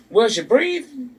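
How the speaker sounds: high mocking Bob voice. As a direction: squeak out the absurd question. Mocking Bob's outrageous question.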